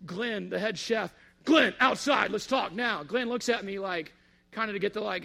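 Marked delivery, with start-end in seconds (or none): 1.5-3.0 sharp, loud call to step outside; a furious boss calling him out; angry command. Retold or imitated. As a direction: bark the order at Glenn. Angry consultant summoning chef.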